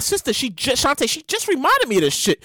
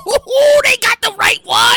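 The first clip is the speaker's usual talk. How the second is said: high, loud whooping excitement; hyped-up gloating; 7 semitones higher, 5 dB louder, slower than usual. Acted. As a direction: whoop it like trouble is coming. Gleeful reaction to a post.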